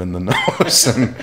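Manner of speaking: amused, laughing through the punchline. Real, not acted. Man telling friends his childhood clown story, laughing. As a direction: laugh breaking into the words.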